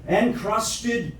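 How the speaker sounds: incantatory poem reading, measured phrases with deliberate pauses; poetry recitation. Acted. Recites own poem after plain chat.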